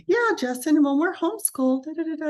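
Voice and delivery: put-on cheerful child. Daughter telling her brother about homeschooling, cheerful. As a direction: voice the daughter, upbeat.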